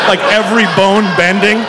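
ordinary delivery

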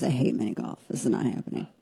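a hushed, voiceless inner thought; inner thought whispered. Retold or imitated. To herself about the mini golf date, whispered dismay. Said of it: "And my first thought was".

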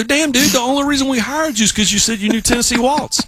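high, exasperated mock rant at the bandleader; incredulous groom, half-joking indignation; retold exasperated outburst. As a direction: act out disbelief, voice jumping up high. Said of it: "I go".

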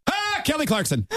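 high breathy moan imitation; orgasm moan. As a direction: moan it out like climaxing. Imitated climax sound.

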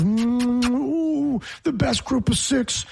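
high, hammed-up mimic of the cohost's pitch; mocking Ken. Host mocks cohost's conference slogan, in a high mocking voice. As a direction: sneer it like a fan repeating his slogan. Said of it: "every single day, there's some kind of".

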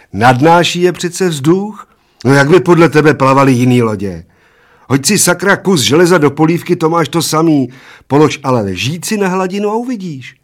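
put-on high, loud little old man lecturing; argumentative little old man. A narrator's reading. Little old man explains floating to his bald friend, high and insistent. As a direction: argue it loudly as a small high-voiced old man.

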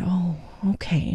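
soft, slow near-whisper; hushed child's reply. Retold or imitated. Daughter answers her sad mommy, hushed imitation. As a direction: imitate a small child softly taking it in.

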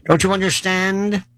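normal talking voice

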